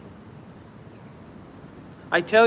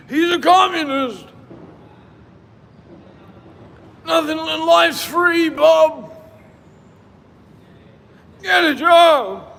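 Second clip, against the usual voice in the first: high, loud mocking old-timer shout; mock gruff heckler; 11 semitones higher, 7 dB louder, slower than usual. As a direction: yell it like a cranky commenter. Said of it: "mocking commenters".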